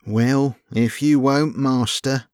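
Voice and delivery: philosophical. Acted. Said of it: "said Albert philosophically".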